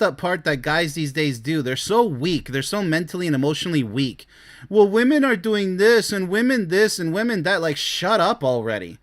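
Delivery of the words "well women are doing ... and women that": mock whiny complainer. Ridiculing weak men who blame women, whiny mimicry. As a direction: parody a guy whining about women.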